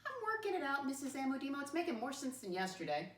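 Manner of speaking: unsure student. Retold or imitated. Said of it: "Thumbs to the side".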